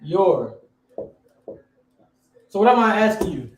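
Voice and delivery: ordinary delivery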